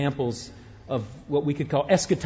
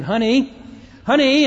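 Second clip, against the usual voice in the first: calling louder and higher, rising; anxious call, getting higher; 8 semitones higher, 7 dB louder than usual. Retold or imitated. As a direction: call for a missing husband. Wife calling husband in film.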